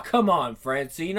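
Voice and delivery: usual speaking voice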